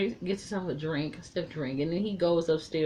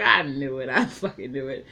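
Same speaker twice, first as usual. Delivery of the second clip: louder, laughing triumph; loud triumphant; 2 semitones lower, 6 dB louder than usual. Retold or imitated. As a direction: crow it out, vindicated. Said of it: "I was like".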